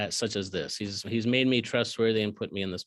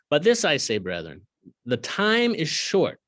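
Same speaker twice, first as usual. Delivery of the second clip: scripture reading; 3 semitones higher, 5 dB louder than usual. Acted. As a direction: lean on the urgency. Reads scripture aloud.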